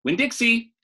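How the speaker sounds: high, loud call across the yard; hollered. Acted. Girl hollering for her dog from the trailer, loud. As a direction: holler the dog's name. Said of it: "I hollered".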